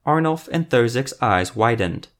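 ordinary delivery, nothing marked

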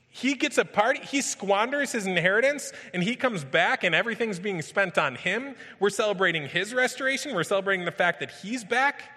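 high, loud, building preacher's intensity; preaching at full force. Acted. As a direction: paint the party scene with rising fervor.

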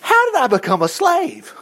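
high, lightly voiced bewildered cry; mock lament. Israelite wondering at slavery, high mock dismay. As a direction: play the dismayed exile. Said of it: "you're going".

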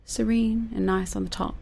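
soft, high and gentle; serene. Shown as a demonstration. Describing the calm duck on top, softly serene. Illustrates duck's serene surface.

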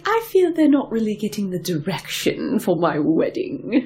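high, slow mock-duchess voice; Duchess of Montenaro. Duchess complains about her wedding plans, slow mock-duchess voice. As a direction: draw it out as a put-on duchess. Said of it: "She's like".

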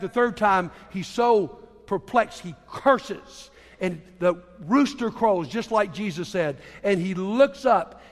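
ordinary delivery

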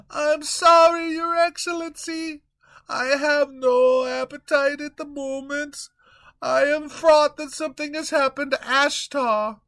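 high, fretful servant voice; worried young servant pleading with his king; timid servant Peter. Acted. Peter figure in sketch.

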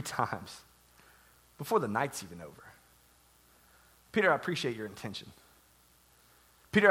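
soft, low, with long pauses; gentle, tender. Acted. Jesus gently correcting Peter's promise of devotion, tender and hushed. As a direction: quietly let him down with patience.

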